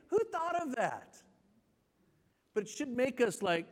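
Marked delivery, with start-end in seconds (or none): 0.1-1.2 very high, incredulous question; appalled disbelief, falling from a high peak. Acted. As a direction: pitch it way up in disbelief. Mock outrage at coining a curse.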